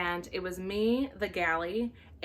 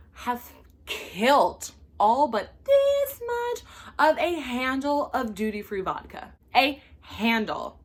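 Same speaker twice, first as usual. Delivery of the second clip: outraged emphasis; 3 semitones higher, 6 dB louder, slower than usual. Real, not acted. Outraged telling of vodka.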